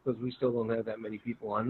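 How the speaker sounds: usual speaking voice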